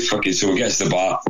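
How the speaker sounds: ordinary talk, nothing marked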